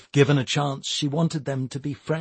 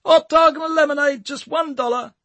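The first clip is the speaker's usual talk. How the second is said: high, louder hawker's cry; more forceful sales call; 6 dB louder than usual. A narrator's reading. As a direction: push the call out harder. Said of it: "Tommy repeated more forcefully".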